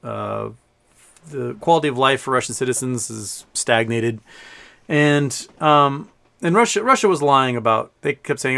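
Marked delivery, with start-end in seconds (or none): none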